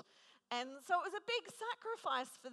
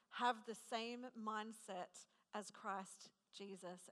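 measured, softer Bible reading voice; scripture reading; 2 semitones lower, 5 dB quieter than usual. Acted. Preacher reading Philippians to the congregation, measured and reverent. Reads scripture passage aloud.

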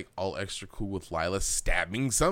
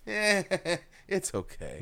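mock nonchalant; 5 dB quieter, slower than usual. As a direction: toss it off like nothing happened. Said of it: "Oh, nonchalant about it".

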